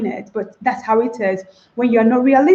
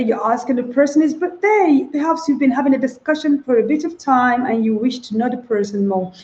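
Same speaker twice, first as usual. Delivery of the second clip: ordinary talk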